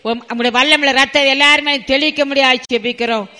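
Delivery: loud, high-pitched, impassioned praying; fervent prayer. Real, not acted. Preacher begging God to send fire and heal the people, fervent prayer. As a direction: cry out to God in urgent, repeated pleas.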